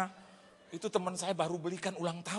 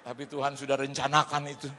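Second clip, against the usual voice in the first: normal talking voice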